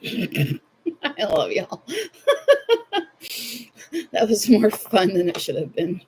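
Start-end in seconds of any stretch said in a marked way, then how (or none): 0.0-1.9 dissolving into real laughter; real giggly laugh through the words. Real, not acted. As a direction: laugh it out, high and breathless. Laughing at own joke.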